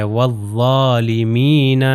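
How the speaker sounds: والظالمین very slowly as "waz-zaalimeena", thick z; والظالمین drawn out, waw into a heavy double z; heavy zoey. Shown as a demonstration. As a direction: recite والظالمین with long held vowels. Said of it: "یعنی وہی زوئیں مگر اس کا تلفظ".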